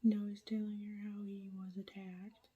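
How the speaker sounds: hushed late-night whisper to the camera; whispered update. Real, not acted. Vlogger whispering a reading update at night, hushed whisper. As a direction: keep it all breathy and hushed so nobody wakes.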